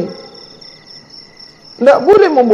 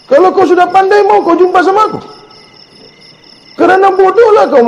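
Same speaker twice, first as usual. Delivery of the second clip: raised high, pushing voice; heated; 9 semitones higher than usual. Real, not acted. Preacher heatedly telling the pupil why he must learn. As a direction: press the point up high.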